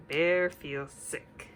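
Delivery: usual speaking voice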